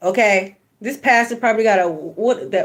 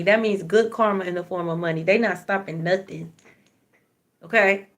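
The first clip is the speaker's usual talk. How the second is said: ordinary delivery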